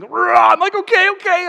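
sudden high, loud burst then okay okay; emotional outburst. Retold or imitated. Man overwhelmed at the table, loud and high. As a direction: break out, overcome, then steady yourself.

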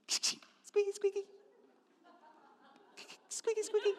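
tiny high, soft squeak; squeaky window noise. Retold or imitated. Little girl cleaning the window, squeaking. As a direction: squeak like a cloth on glass.

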